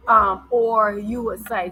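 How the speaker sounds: ordinary talk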